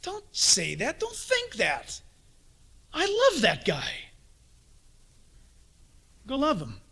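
a hint of God's gentle rebuke; voice of the Lord scolding. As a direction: stop them short, then plead for love. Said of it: "the Lord says to you".

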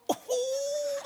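high whiny dog voice; pleading like a desperate little dog; dogs whining. Retold or imitated. Preacher voicing his waiting dogs, high whine. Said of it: "they're just sitting by the door going".

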